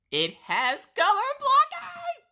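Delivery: high squealing excitement; excited shout. Real, not acted. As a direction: shriek it out with delight. Excited reveal of painting.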